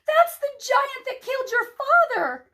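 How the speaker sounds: high, loud horrified cry; high, mild horrified shriek. A narrator's reading. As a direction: cry out in horror. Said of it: "cried out in horror".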